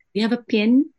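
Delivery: mock Kiwi asking for a pen, "pen" sounding like "pin"; New Zealand accent. Teacher imitating a Kiwi colleague's accent, mock Kiwi voice. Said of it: "they pronounce E as I".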